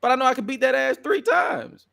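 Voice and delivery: hammed-up high voice of a cocky wrestler; mock heel rant. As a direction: play the petty wrestler demanding his title shot. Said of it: "He like".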